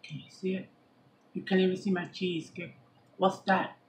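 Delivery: usual speaking voice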